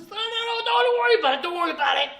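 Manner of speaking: high, loud mock placating; gushing don't-panic patter, easing off by the end; frantic reassurance. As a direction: rush to calm someone down, imitating a bubbly assistant. Acting out assistant frantically placating him.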